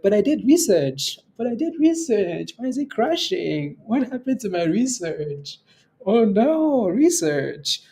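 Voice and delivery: high, mock-whiny protest; mocking whiny Jackie. Host mocking Jackie's complaint to viewers, high whiny mock. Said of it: "She's like".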